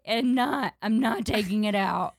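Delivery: half-hearted retold crying yell; mock scream-crying. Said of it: "I start scream crying".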